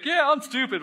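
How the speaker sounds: high, light mock self-insult; breezy joke at own expense; joking self-put-down. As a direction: toss it off with a joking laugh in the voice. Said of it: "joking, like".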